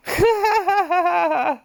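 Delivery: high burst of laughter; laughing. Real, not acted. Laughing at her own Japanese accent. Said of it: "that sounds way better".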